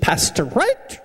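high, prim English lady voice; high-pitched mock scold, sit down and shut up; prim director. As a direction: mimic the proper director scolding. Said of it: "she interrupted me, and she said".

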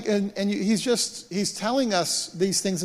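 usual speaking voice

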